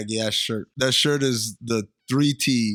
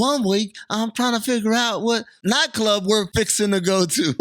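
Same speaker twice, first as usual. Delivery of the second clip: high, hammed-up Southern twang; high-pitched comic Southern voice; mock Southern accent; 8 semitones higher than usual. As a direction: put on an exaggerated Southern accent. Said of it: "decides to just do an accent".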